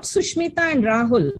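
ordinary delivery

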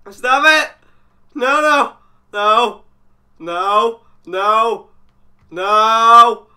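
loud, high bursts of protest; panicked refusal. Real, not acted. Player begging the monster to stop, panicked shouting. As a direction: shout each no separately, in alarm.

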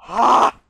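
sudden loud yelp from slipping; startled yelp. Real, not acted. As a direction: yelp as your foot slips. Nearly slipping on ice.

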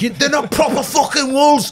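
very high-pitched, caricatured Scouse outburst; angry Scouser. Acted. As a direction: squeal it like an outraged Liverpool local. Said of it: "This guy after the show wanted to fucking batter me".

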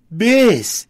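high, loud call of a name; excited greeting. A narrator's reading. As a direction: cry out to a friend arriving. Greets long-awaited friend by name. (chamado empolgado, agudo e forte)

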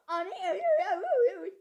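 shrill cries of pain; panicked screaming. Real, not acted. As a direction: yelp in panic.

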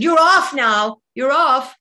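loud, high, commanding yell; boss barking orders. Retold or imitated. Club boss sending the bunny off her shift, loud order. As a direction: bark it out like a boss who won't be argued with.

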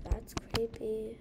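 ordinary delivery

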